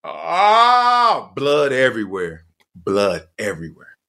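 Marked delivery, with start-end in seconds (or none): 0.0-1.3 pained cry. Retold or imitated. Pain on landing.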